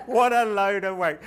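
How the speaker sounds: loud, high scoffing; mock disgusted teenager, loud; scornful teen. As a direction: scoff at the old men. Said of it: "he's going".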